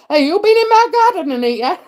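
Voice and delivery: loud, high playful accusation; hammed-up "caught you" tease; mock accusation. Teasing a viewer about her garden, playfully accusing.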